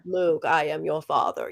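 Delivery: Darth Vader voice. Retold or imitated. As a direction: growl it out like Vader. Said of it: "he's talking into the fan".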